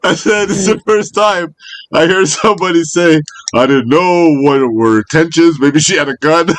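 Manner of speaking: high-pitched, incredulous ribbing; amused teasing. Real, not acted. Friend repeating Josh's gun worry back to him, amused teasing. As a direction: tease him, on the edge of cracking up.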